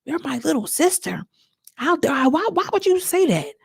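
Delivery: high, indignant outraged denial. Shown as a demonstration. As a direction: give the denial real fire. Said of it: "I need some more energy behind that".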